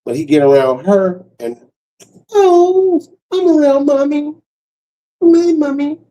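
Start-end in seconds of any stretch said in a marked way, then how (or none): 2.3-6.0 put-on high-pitched, whimpery mommy's-boy dog; babyish dog. Tough dog melting around his mommy, high babyish voice. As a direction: play a pampered dog in a high little voice.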